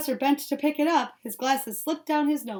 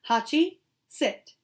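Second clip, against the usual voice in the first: slow, firm command to a puppy; serious command; 4 semitones higher, slower than usual. A narrator's reading. Master training his dog, serious slow command. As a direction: give the order seriously, slowly. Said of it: "gave the command in a serious tone of voice".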